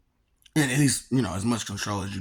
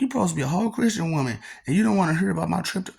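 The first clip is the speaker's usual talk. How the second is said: fast, high, louder retort at his mother; indignant protest; 8 semitones higher, 4 dB louder, faster than usual. Retold or imitated. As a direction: rattle it off in disbelief. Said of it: "I'm like".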